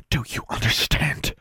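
hissed. A narrator's reading. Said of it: "Miss Grimm hissed".

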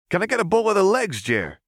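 put-on eager kid asking. A narrator's reading. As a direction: blurt the order out eagerly. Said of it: "Clagger asked".

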